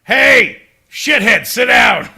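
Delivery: loud, high yell; rowdy mock-angry hollering; heckler's shout. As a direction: bellow it at someone to get their attention. Acted heckler yelling.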